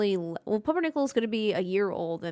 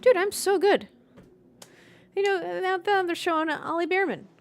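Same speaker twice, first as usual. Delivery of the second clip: excited; 8 semitones higher, 5 dB louder than usual. Real, not acted. Bragging about prediction.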